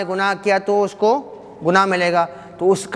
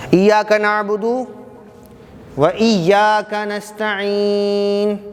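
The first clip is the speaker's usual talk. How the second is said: very slow, stretched melodic chant; Quranic recitation; much slower than usual. Shown as a demonstration. Teacher reciting the verse in class, very slow melodic chant. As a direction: draw out each word of the verse.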